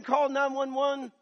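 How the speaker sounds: high, loud, slow shout; husband screaming to call for help; screamed order. Retold or imitated. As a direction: yell the order at whoever is near. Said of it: "I'm screaming".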